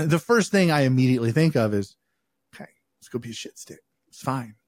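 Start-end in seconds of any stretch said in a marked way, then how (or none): 2.5-4.6 hushed, near-silent private thought, fast; whispered inner thought. Retold or imitated. To himself on being handed a cigar, a quick whisper. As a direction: mutter it under your breath, quick.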